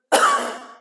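short loud put-on cough; recreated cough. Patient recreating his cough for the doctor, put-on cough. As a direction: force out a cough for show. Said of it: "Try to recreate it".

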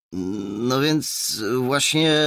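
slow, high, drawn-out stalling; awkward hesitation. A narrator's reading. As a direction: stretch the words, unsure what to answer. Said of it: "posterunkowy podrapał się za uchem". (przeciągle, z zakłopotaniem)

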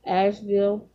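ordinary delivery

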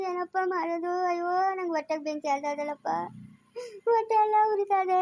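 long sing-song weepy lament; tearful wailing. Acted. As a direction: bewail the loss with dragged-out endings. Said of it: "ನಿಲ್ಸಮ್ಮ ಕಣ್ಣೀರ". (ಎಳೆದು ಎಳೆದು ಗೋಳಾಡುತ್ತಾ ಅಳುವುದು)

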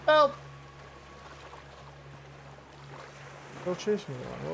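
panicked cries for help; scared horror game streamer. Real, not acted. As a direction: flee in a mild panic. Fleeing the creature, calling help.

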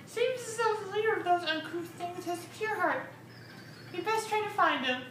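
lightly voiced prim noble; high formal voice. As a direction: speak it high and proper. Voicing a noble character.

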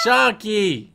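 loud call to the pug. Real, not acted. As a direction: call the dog's name out high. Calling the dog in game.

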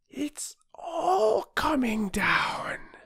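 high, slow, barely voiced; voiceless whisper. A narrator's reading. Boy too frightened to speak, whispered. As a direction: gasp it with almost no voice. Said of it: "hardly any voice to say it with".

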